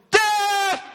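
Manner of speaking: very high, loud war shout; rally the cavalry with one cry; battle cry. Retold or imitated. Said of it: "And they all go".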